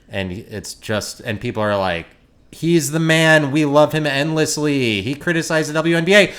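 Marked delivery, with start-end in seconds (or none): 2.5-6.3 mocking fan praise. Mocking the crowd that worships Shane Gillis, gushing and hammed-up. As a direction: ham up the starstruck fans.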